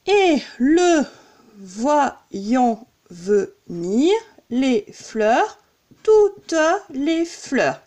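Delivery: very slow scansion, one beat per syllable; deliberate, measured verse count. Shown as a demonstration. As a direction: chop the verse into separate syllables. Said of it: "quand on compte".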